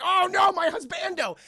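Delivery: high, loud hammed-up wail; mock horrified cry. Anna sees Lewis being bitten, mock horror. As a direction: cry out in exaggerated alarm. Wife sees husband attacked.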